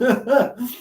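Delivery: a burst of high laughter at her own joke. Real, not acted. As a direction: laugh out high, amused at herself.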